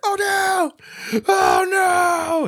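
high, loud hammed-up cry of alarm; mock panic. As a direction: squeal it like a panicked fan. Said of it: "And he's like".

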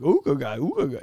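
mock cartoon wolf-whistle ooh; cartoon ogling noises. Man gawking at a jogger, mock cartoon leer. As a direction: ham up a goofy leering ooh. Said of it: "do, like, cartoon noises like".

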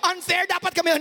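put-on high, complaining kid voice; whiny child. Kids protesting to their parent, high and whiny. Siblings complaining about fairness.

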